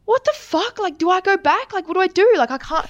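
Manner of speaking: high-pitched flustered questions; retold panic. Rider learning her friend crashed, high flustered panic. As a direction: relive the panic, pitch shooting right up.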